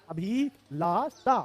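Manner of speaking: Abhilasha very slowly, "a-bhi-laa-saa"; drawn-out syllables. Shown as a demonstration. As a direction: stretch and separate each syllable of Abhilasha. Teacher sounds out the spelling.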